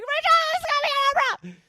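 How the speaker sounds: very high, fast, ecstatic fan shriek; excited scream. Retold or imitated. Wife screams big Oprah news to husband, ecstatic shriek. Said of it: "I screamed".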